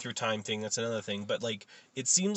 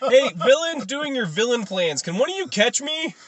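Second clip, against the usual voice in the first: high, loud, helpless plea; comic calling out; 10 semitones higher, 8 dB louder than usual. Acted. Flash stuck in the rafters calling villains, high mock plea. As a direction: call down for help in a hammed-up high voice.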